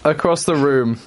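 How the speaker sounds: ordinary talk, nothing marked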